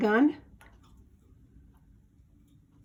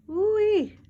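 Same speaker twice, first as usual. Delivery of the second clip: ooh-wee; 9 semitones higher, 6 dB louder than usual. Real, not acted. Delighted at bird's trick.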